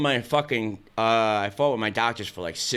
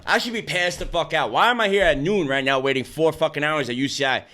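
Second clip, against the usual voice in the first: ordinary talk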